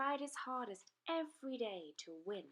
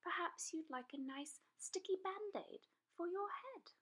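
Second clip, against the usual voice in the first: put-on higher, helpful little girl; higher little girl offering help; little girl's voice; 9 semitones higher than usual. A narrator's reading. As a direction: offer it kindly like a small girl. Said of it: "she said".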